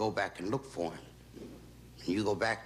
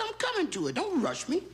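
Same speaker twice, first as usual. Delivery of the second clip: much higher, squeaking in a fluster; a guide cornered, voice jumping way up; high-pitched don't rush me; squeaky flustered guide. Acted. Guide fends off the pushy lady.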